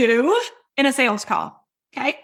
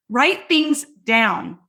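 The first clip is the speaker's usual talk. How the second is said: slow repeat; 3 semitones lower, much slower than usual. Shown as a demonstration.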